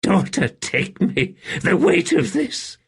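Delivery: high, strained emperor's lament; sagging despair. A narrator's reading. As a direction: sag under an unbearable burden. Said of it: "Then he sagged in a rustle".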